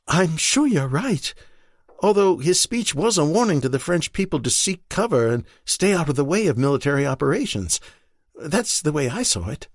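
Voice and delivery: an urgent, worried rush of questions; Marion, anxious wife. Acted. Marion answers Stephen.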